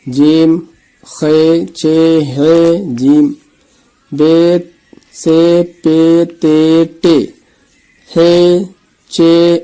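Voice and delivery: teacher drilling alphabet letters; slow letter drill. Shown as a demonstration. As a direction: spell out the letters slow and clear for a learner. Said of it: "اس کو حروف کو پہچاننا ہے آپ کو".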